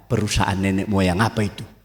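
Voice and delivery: ordinary talk, nothing marked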